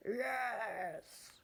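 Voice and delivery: high, delighted cry; joyful witch. Acted. Witch delighted with her new broom, high joyful cry. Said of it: "cried the witch".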